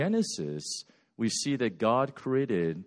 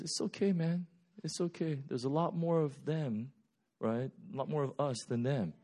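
softer, laid-back reassurance; cool and calm; 10 dB quieter than usual. Retold or imitated. Elisha calming his frightened servant, soft and cool. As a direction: soothe him like nothing is wrong. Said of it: "Elisha is so cool".

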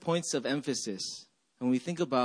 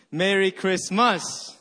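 "Christmas" with MAS punched; slow phrase, land hard on MAS in "Christmas"; stress on last syllable; 4 semitones higher, 4 dB louder, slower than usual. Shown as a demonstration. Pastor leading the game together, stress on MAS of "Christmas". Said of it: "you emphasize every syllable".

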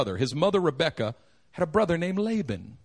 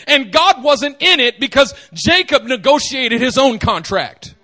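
ordinary talk

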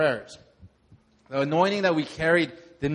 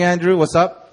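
ordinary delivery

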